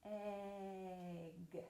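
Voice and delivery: soft, drawn-out "Egg"; "Egg" said low and very slowly, eeegg; really slow. Shown as a demonstration. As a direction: stretch the vowel of "Egg" right out. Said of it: "Let's say it really slowly".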